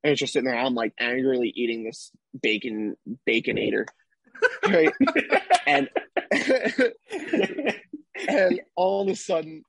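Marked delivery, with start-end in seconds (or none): 5.0-5.6 bursts into a high laugh. Real, not acted. Laughing at himself over the Baconator, high laugh. Laughing at his own story. 5.7-9.6 laughing through words. Real, not acted. Still laughing while telling.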